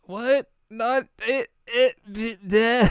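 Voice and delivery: high, squeaky broken stutter; stunned stammer. Retold or imitated. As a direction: splutter helplessly in a put-on high voice.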